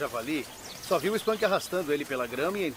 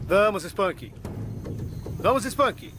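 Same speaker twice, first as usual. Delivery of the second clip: calling out; 5 semitones higher, much slower than usual. Real, not acted. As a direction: call out hard to the animal. Calling the alligator louder.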